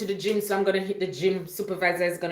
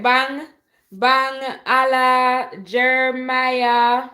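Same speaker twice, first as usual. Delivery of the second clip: loud, raised roll call; calling out names; 5 semitones higher, 8 dB louder, much slower than usual. Real, not acted. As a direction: sing out each viewer's name, held long. Calling out viewers' names in greeting.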